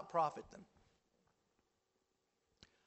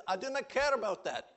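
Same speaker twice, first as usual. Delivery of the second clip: quoted preacher; 6 semitones higher, 5 dB louder than usual. Retold or imitated. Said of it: "He goes".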